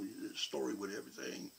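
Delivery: ordinary talk, nothing marked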